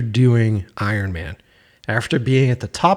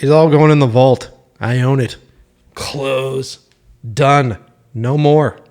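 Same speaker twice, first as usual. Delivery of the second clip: bitter refusal; 5 dB louder, slower than usual. Retold or imitated. Imagined bitter creator lockdown.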